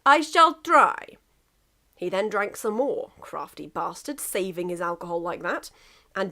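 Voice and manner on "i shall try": put-on slow, loud heavy Russian accent; deliberate Russian complaint. Russia calling out from his tank hatch, heavy Russian accent. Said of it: "Russia continues".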